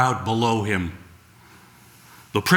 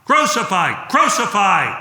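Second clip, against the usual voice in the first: rising chant, louder and higher each time; crowd shouting; 6 semitones higher, 4 dB louder than usual. Acted. The mob demands death in a building, higher shout. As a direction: build the chant from call to roar. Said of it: "the crowd shouted".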